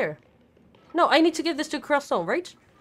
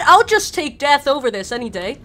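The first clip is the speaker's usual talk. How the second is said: exasperated; 8 dB louder than usual. Real, not acted. Repeated exasperated line.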